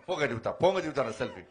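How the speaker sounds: ordinary delivery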